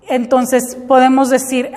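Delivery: ordinary delivery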